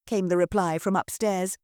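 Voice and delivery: usual speaking voice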